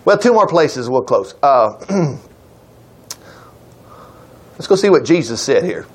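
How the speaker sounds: normal talking voice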